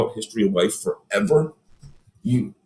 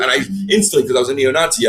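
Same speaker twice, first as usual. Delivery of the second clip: ordinary talk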